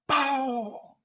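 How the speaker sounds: high, sharp mock gobble; imitated gobble burst. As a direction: pop it out like the tom answering.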